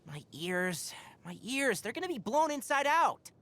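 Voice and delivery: rising from tense to high-pitched alarm; distressed complaint. Acted. Forest watcher fearing for their ears in the wind, distressed. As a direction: build to a high, pained protest.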